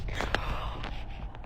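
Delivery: sharp, breathy gasp of fright; frightened gasp. Real, not acted. Woman startled by a noise in the house, frightened gasp.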